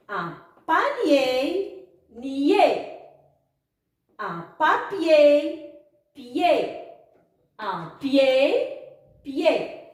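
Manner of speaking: slow word rising, then its last syllable falling; syllable-reading drill. Shown as a demonstration. Teacher models words and syllables for pupils, slow reading drill. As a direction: read each word slowly, then isolate its final syllable. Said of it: "Passons maintenant à lire quelques mots et quelques syllabes".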